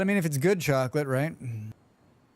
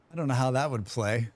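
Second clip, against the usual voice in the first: normal talking voice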